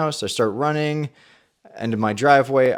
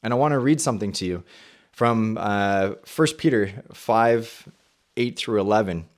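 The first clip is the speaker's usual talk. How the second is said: ordinary talk, nothing marked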